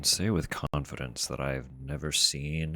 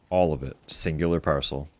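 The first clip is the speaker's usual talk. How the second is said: excited; 6 dB louder than usual. Real, not acted. Thrilled the order shipped.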